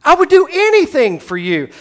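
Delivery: high, loud, eager vow; eager Peter. Retold or imitated. Preacher voicing Peter's loud eager vow. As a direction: blurt out the devotion. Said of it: "Peter is going to say".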